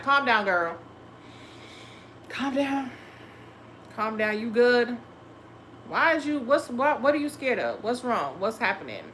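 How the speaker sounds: soft, reassuring self-talk; gentle self-talk. Shown as a demonstration. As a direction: talk yourself down kindly, like a friend. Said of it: "I'ma speak better".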